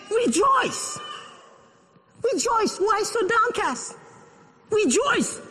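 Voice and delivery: high exultant call to rejoice; Paul urging rejoice. Retold or imitated. Preacher quoting Paul, ringing out. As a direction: lift them up with Paul's words. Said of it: "And Paul goes on, he says".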